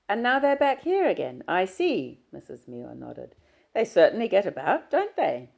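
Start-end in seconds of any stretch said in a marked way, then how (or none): 0.1-2.2 put-on kindly older employer, higher and louder; Mrs Muir's voice. Employer reassures Diana about the visit, kindly and raised. 3.7-5.5 put-on kindly older employer, higher and louder; Mrs Muir's voice. Employer reassures Diana about the visit, kindly and raised.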